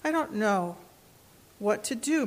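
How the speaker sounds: usual speaking voice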